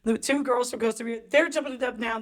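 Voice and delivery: usual speaking voice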